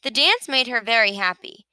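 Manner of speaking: ordinary delivery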